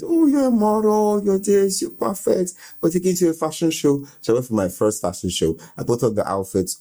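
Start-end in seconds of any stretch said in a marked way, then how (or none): 0.0-4.1 high, excited gushing praise; aunt's gushing voice. Retold or imitated. Aunt flattering her niece at the agency, gushing high. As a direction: gush like a flattering agency boss. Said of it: "she went".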